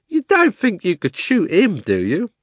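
high, loud cabby voice; loud, high-pitched challenge from a cabby; incredulous cabby. Acted. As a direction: scoff in disbelief as the cabby. Cabby scoffs at shooting.

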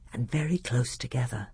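plain reading voice

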